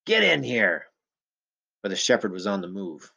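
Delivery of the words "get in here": raised, loud call with falling pitch; yelled command. A narrator's reading. Big man calling his dog over his shoulder, yelling. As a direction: yell for the dog to come. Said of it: "The man yelled over his shoulder".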